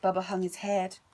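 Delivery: ordinary delivery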